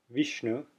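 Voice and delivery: "Vishnu" with a short ee like England; Vishnu with ee sound. Shown as a demonstration. Teacher contrasting the two vowel sounds. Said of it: "you hear the difference".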